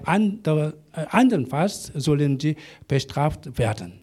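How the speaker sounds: normal talking voice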